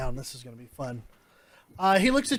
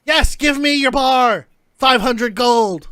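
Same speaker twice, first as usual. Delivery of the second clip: louder, slow and insistent; demanding bar buyer; 3 semitones higher, 9 dB louder, slower than usual. Acted. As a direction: lay down the offer like it's final.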